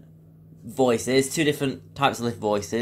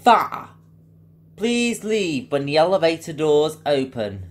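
lift's recorded emergency announcement; fire service message; 3 semitones higher than usual. Shown as a demonstration. Impressionist demos the lift's fire warning, announcer voice. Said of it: "The fire service message".

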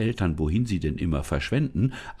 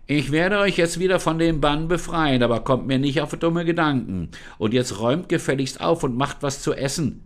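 bossy creature voice, higher and louder; commanding false Lisa; 8 semitones higher, 3 dB louder than usual. Acted. False Lisa commands her parents to clean and cook, bossy. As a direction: order them about like servants. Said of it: "kommandierte die falsche Lisa".

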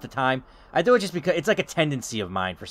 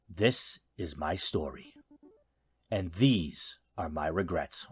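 noir game narration; 5 semitones lower than usual. Acted. Game narrator opens his dark story of regrets, low narration. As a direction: read it low and heavy, pausing between phrases. Reads game's opening narration aloud.

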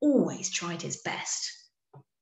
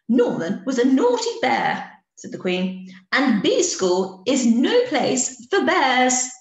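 louder, slow stern ruling; stern Queen; 5 semitones higher, 6 dB louder than usual. A narrator's reading. Queen bee declaring no bears in school, stern. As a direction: lay down the law.

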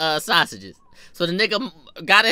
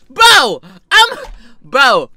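loud, exasperated outburst; loud comic exasperation; 6 semitones higher, 12 dB louder, much slower than usual. Acted. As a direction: burst out in disbelief. Exasperated after mocking her.

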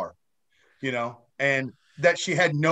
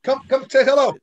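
raised, higher beckoning voice; calling over; 7 semitones higher, 3 dB louder, faster than usual. Real, not acted. Guest invites daughter to say hello, calling over. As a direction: coax someone over to join in.

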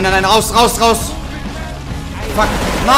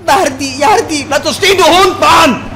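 angry yelling; 6 semitones higher, 3 dB louder, slower than usual. Real, not acted. Yelling at fleeing target.

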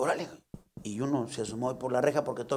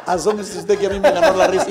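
laughing compadre; 4 semitones higher, 7 dB louder than usual. Acted. Repeats compadre line laughing.

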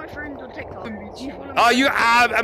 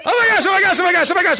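high, rapid-fire exclaiming; excited gushing; 6 semitones higher, faster than usual. Real, not acted. Streamer thrilled as players arrive, excited high gushing. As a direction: gush it over and over, high.

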